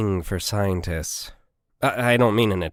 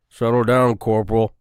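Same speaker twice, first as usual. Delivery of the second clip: firm, louder rebuke; scolding; 6 dB louder than usual. Acted. Sergeant scolds the corporal for her outburst, firm louder rebuke. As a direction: put the corporal in her place. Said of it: "scolded Marks".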